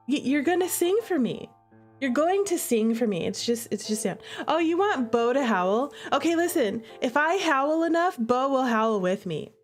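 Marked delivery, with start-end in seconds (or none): none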